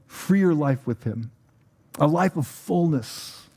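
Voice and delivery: slow, rising preacher's appeal; sermon climax. Acted. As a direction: stretch out each phrase as a call. Closing sermon exhortation.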